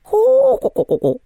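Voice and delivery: high mocking bird cluck; long hoot breaking into rapid clucks. A narrator's reading. Mermaid jeering at the cat, clucking. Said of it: "заквохтала тётя Лариса".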